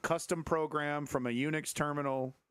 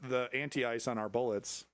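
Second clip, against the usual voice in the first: normal talking voice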